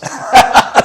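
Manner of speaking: short, high laugh; amused laugh. Real, not acted. Host tickled by nine dishwashers, amused laugh. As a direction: laugh at your own aside.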